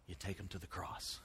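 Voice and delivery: hushed whisper, as a secret answer; soft, confiding whisper of the key point. Acted. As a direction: lean in and whisper it.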